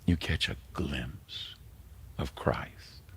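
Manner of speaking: slow, soft, falling away to a whisper; reverent whisper. Real, not acted. Pastor promising a glimpse of Christ, slow reverent whisper. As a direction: breathe it out slowly in awe. Climax of sermon point.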